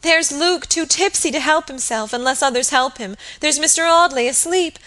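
high, urgent, agitated listing; distraught alarm. A narrator's reading. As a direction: fret aloud in panic. Distraught maid lists those in danger.

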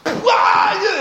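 high excited yelp; excited shriek. Acted. Rhoda rushing back to the praying church, a high excited yelp. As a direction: yelp as you run in.

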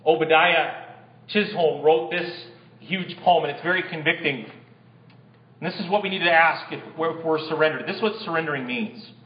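ordinary talk

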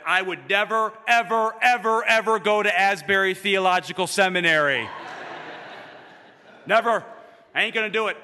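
loud, high-pitched, mock-defiant refusal; retold vow, belted out for comic effect; emphatic vow. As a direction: build to a big, stubborn proclamation. Retelling his emphatic vow.

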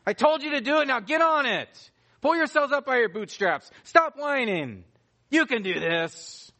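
high, loud mock berating; gruff drill-sergeant scolding. God wrongly imagined telling wimps to get back to work, harsh scolding. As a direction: order the wimps to toughen up, forcefully. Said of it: "God isn't saying, "All right now, come on, you wimps".

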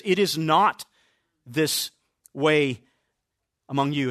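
"It is not" then a pause, "this", a pause, "way", a pause, "among you"; deliberate pauses. Shown as a demonstration. Preacher showing the congregation how Jesus worded it, with pauses. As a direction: space each of "this", "way" and "among you" apart to weigh them. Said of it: "notice how Jesus words it".

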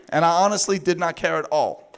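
ordinary delivery

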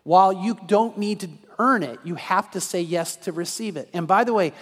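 loud, rising, urgent sermon delivery; full-force preaching. Acted. Pastor urging listeners to commit, preached loud and fervent. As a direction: push the appeal hard to the congregation.

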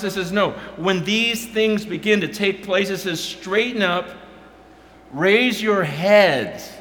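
high, loud, fervent preaching; full-force preaching. Acted. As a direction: preach it out to the crowd.

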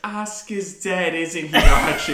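high, slow, drawn-out Edith voice; high slow laughing Edith quote; Edith impression. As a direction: stretch it out sweetly like a dim wife. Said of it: "He goes".

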